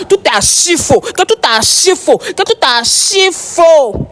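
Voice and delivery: high, increasingly drawn-out ARCHI FAUX; insistent, slowing repetition climbing in pitch; emphatic denial. Emphatic denial of live claims.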